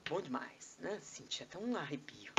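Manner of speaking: soft, hushed mock admiration; awed listener. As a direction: drop to a thrilled near-whisper. Acts awed listener after booming voice.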